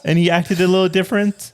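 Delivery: high, sweet therapist voice. Pretend. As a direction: gently coax a young patient. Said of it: "act out a possible therapy session".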